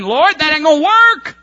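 mock griping at heaven; complaining to God. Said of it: "looking at God in heaven and saying".

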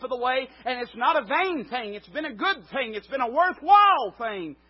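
loud, high, impassioned sermon; fervent preaching. Acted. Pastor preaching hard to his congregation, fervent and loud. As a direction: build it up like a revival preacher.

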